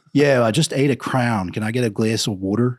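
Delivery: broad Philadelphia vowels, punched out; Philly accent. Shown as a demonstration. Joking request for water, Philly accent. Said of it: "It's a weird accent in Philly".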